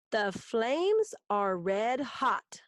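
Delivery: slow, measured reading, each word its own beat; syllable by syllable. Shown as a demonstration. Teacher reading own haiku to pupils, slow syllable count. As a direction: read it slowly enough to count the syllables. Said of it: "That's five syllables".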